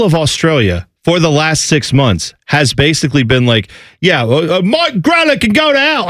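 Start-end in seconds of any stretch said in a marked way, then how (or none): 4.0-6.1 high, hammed-up Australian accent; a muddled imitation of an angry Australian fan; mock Australian accent. Imitating an Australian fan cursing the analyst in a mock Australian accent. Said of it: "I don't even know what accent that is".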